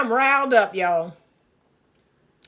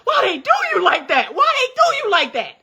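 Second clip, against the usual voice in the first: high, exasperated plea, Lord why; pleading shout to the Lord; exasperated cry to God; 7 semitones higher than usual. Retold or imitated. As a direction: throw your hands up at God. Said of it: "But I be like".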